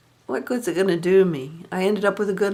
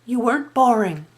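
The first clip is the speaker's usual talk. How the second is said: dismissive quote; 6 semitones higher than usual. Retold or imitated. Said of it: "she said".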